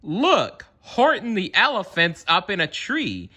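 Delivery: high, gleeful jeering shout. A narrator's reading. Friends jeering gleefully at Horton up the tree. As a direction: shout it to mock him. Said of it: "they shouted with glee".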